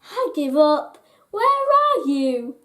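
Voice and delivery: plain reading voice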